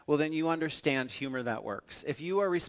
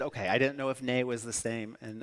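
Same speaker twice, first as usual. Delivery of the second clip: ordinary talk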